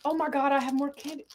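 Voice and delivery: ordinary talk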